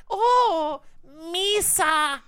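lightly voiced high creature; squeaky little alien voice; Baby Yoda impression. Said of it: "That's not what he sounds like".

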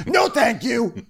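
very high put-on shout; mock refusal in a shrieky high voice; fake Danielle. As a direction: cry it out in falsetto. Playing Danielle refusing the telemarketer.

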